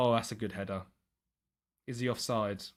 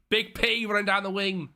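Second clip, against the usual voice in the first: raised, sing-out commentator call; excited commentary; 6 semitones higher, 3 dB louder than usual. Real, not acted. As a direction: cheer the winger on. Calling the attack in game.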